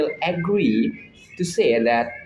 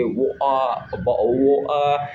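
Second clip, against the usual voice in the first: imitated British vowels, half-formed words; mock British accent. Imitating a Harry Potter-loving friend, mock British accent. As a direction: toss out mock British syllables. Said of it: "talk like a British people".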